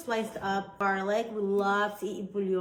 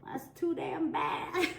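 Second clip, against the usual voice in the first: laughing; 8 semitones higher, slower than usual. Real, not acted. Laughing at husband's reply.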